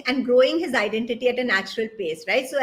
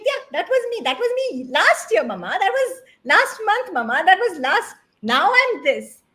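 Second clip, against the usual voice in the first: higher, breezy kid voice, lightly voiced; child's voice; 6 semitones higher than usual. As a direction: shrug it off like a kid. Son answering mother in story.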